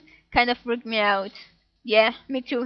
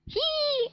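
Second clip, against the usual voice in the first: high squeaky creature cry; 4 dB quieter than usual. Acted. Voicing Pokemon cry.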